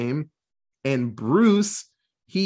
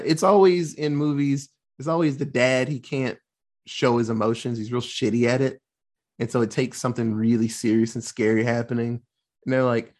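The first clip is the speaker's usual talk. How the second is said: ordinary talk, nothing marked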